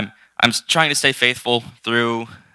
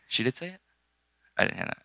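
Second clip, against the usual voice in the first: high, very soft puzzled question; mock disbelief; 8 semitones higher, 10 dB quieter, faster than usual. Speaker recounting her reaction to Sadie's news, mock disbelief. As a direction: retell a hushed, puzzled reaction.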